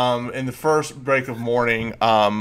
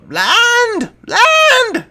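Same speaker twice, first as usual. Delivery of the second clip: high shrill-free piercing kobold cry, much higher; kobold calling out; 6 dB louder than usual. Acted. Lookout kobold calls to the crew from above, high piercing cry. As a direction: shout land from the crow's nest like a tiny kobold. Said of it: "calls out".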